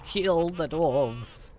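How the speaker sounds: slow, high snarl; menacing goblin. Acted. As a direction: spit it out like a vicious goblin. Goblin character voiced in game.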